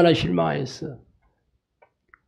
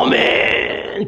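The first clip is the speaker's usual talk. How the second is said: long drawn-out, higher-pitched mock amen; 6 semitones higher, much slower than usual. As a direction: stretch it out like an overdone amen. Sarcastic rejected amen, imagined reply.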